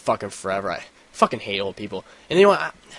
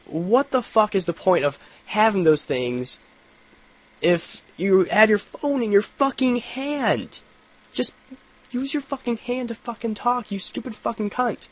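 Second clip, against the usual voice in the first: high, slow, scornful tirade; exasperated rant; 11 semitones higher, slower than usual. Retold or imitated. Vlogger ranting about a woman with an earpiece, high scornful tirade. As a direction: drag it out like you can't believe her.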